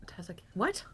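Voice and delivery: hushed, breathy question; whisper. Real, not acted. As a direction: whisper it aside. Whispered aside.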